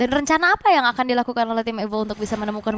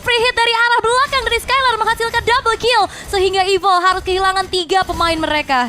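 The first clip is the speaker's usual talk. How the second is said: rising, louder hype call; excited play-by-play; 9 semitones higher, 5 dB louder than usual. Real, not acted. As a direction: build the team fight excitement. Caster hypes team fight.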